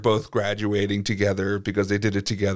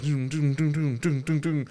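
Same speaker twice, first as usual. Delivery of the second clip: mock rushing sounds; 6 semitones higher, 3 dB quieter than usual. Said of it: "I'm just like".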